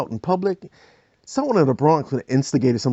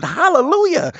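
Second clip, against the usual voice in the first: loud high cry of praise; yelled hallelujah; 4 dB louder than usual. Retold or imitated. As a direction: call it out in sleep. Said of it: "she said".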